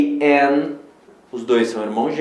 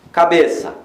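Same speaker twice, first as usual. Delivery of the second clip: normal talking voice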